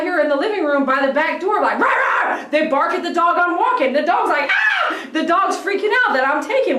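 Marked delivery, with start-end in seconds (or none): 1.8-2.4 high yappy "rah rah" bark, higher; imitated dog bark. Imitating the dogs barking. 4.5-5.1 sudden high shouted yelp, much higher; imitated dog yelp. The walked dog freaking out.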